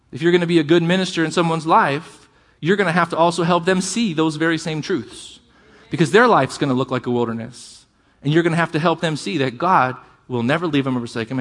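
normal talking voice

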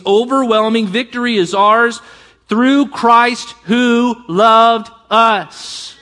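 fervent scripture reading. Acted. Pastor reading Romans to congregation, fervent proclamation. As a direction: proclaim the passage with rising conviction.

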